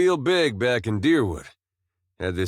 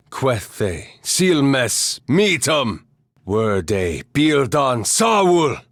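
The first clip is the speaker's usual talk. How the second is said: slow chant building to a raised cry; spell incantation; 3 semitones higher, 3 dB louder, much slower than usual. Acted. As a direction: intone each magic phrase with mounting force. Casting spells in invented language.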